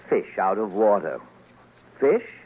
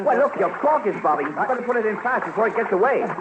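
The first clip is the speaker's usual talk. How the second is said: higher and fast, urgent; excited fisherman; 8 semitones higher, faster than usual. Acted. Narrator playing a fisherman urging his friend, excited and fast. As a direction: hurry your fishing buddy before the fish escapes. Fishing scene, cork bobbing.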